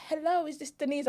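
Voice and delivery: put-on British accent, pitched high. Phoning sister from London on arrival, in a put-on British accent. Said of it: "I put on a British accent as well".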